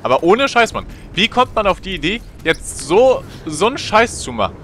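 high disbelieving voice; astonished. Real, not acted. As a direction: marvel at the madness. Astonished at height.

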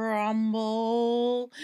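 deep voiced crumble; low crumbling rumble. Creepy house crumbles around the cats, low rumble.

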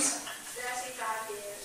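quiet, drawn-out weary answer; long-suffering wife's soft reply; pained. Real, not acted. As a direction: say it slowly, as if it hurts. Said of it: "I can feel pain in your voice there".

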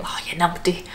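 a soft, lightly voiced old granny; grandma voice. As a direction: say it like a fond grandmother chiding. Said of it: "like, a grandma saying it".

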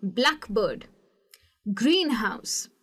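Blackbird with the stress on black, greenhouse with the stress on green; stress on first part. Shown as a demonstration. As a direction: say Blackbird and greenhouse slowly, leaning on the first half of each. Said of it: "तो मैं कहूँगी". (Blackbird में black पर और greenhouse में green पर ज़ोर)

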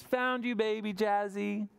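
high, glad found-you; happy mother. Acted. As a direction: sing out the find. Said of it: "She says".

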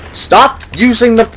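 high, slow exasperated yell; panicking gamer. Real, not acted. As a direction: shriek it out in frustration. Frustrated with game controls.

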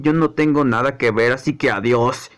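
deep, low character voice; dismissive figure. Acted. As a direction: shrug off the problem in a low voice. Same figure refuses and leaves.

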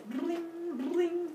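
high, light ringing plucks; imitated strumming. Apollo strumming away on Olympus, imitated twangs. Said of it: "just sort of strumming away".

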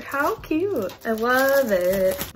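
high then slow loud gush; gushing delight. Real, not acted. Unboxing a cute item, slow gushing delight. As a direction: savour it with delight.

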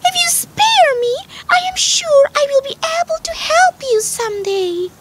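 very high, tiny bargaining voice; pleading mouse. A narrator's reading. Mouse promising help if the lion spares her, high pleading. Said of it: "pleaded the mouse".

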